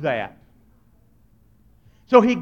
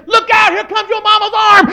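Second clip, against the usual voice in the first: high, loud shout of alarm; friends yelling a warning; 12 semitones higher, 6 dB louder than usual. Retold or imitated. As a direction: yell it down the street like a kid warning a friend. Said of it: "Your friends say".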